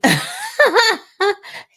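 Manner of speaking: high burst of laughter; loud laugh. Real, not acted. Laughs at her own joke.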